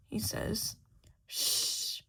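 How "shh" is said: sharp whispered shh; urgent whispered hush; hissed shush. A narrator's reading. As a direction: hiss it to hush him. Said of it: "she hisses".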